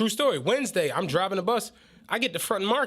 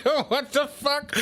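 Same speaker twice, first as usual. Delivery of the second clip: laughing; 5 semitones higher than usual. Retold or imitated. Retold shock at wrecked car.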